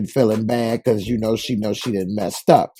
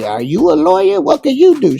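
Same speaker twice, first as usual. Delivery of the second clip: mock Big Mama; 13 semitones higher, 6 dB louder than usual. Big Mama questioning Jerica.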